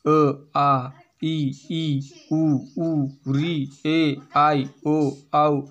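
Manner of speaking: अ आ ई उ ऊ said one by one; vowels recited. Shown as a demonstration. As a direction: recite each vowel clearly for pupils. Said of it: "स्वर के रूप में देखो तो". (स्वर एक-एक करके बोलते हुए)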